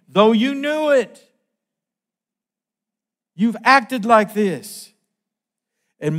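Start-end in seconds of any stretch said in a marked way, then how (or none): 0.1-4.9 loud, slow scripture rebuke; preaching at full force. Acted. Preacher reading Daniel's rebuke to the king, loud and slow. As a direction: thunder the rebuke out slowly, word by word.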